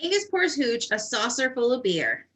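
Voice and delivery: ordinary talk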